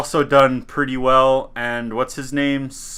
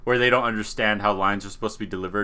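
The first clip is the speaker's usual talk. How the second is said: ordinary delivery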